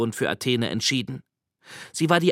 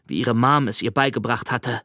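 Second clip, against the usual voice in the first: ordinary talk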